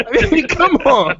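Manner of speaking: high, laughing disbelief; laughing protest. Real, not acted. Dad laughing off a nine thirty bedtime, laughing protest. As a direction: protest through a real laugh.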